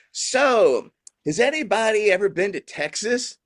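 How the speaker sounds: a hint of a stand-up working the room; female comedian on stage. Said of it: "this comedian on stage is like".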